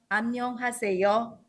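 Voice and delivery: "Annyeonghaseyo." said slowly in Korean; Korean greeting. Shown as a demonstration. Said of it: "And in Korean".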